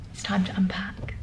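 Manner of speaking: quiet, whispered resolve; a gentle whispered send-off; soft whisper. Real, not acted. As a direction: hush it to yourself. Quiet end of emotional bit.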